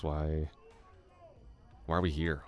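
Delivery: ordinary talk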